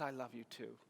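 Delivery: gently hushed, like into an ear; retold whisper. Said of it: "he whispered flawlessly in my ear".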